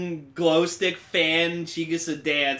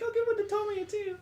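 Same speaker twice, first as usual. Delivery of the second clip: much higher put-on girly idol voice; idol girl voice; 6 dB quieter, faster than usual. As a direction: chirp it like a sweet pop idol. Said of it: "it's like".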